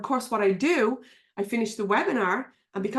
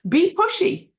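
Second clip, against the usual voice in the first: much higher and louder, rising on pushy; fired-up command; urging; 9 semitones higher, 6 dB louder than usual. Real, not acted. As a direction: fire the audience up with "pushy". Urging the audience.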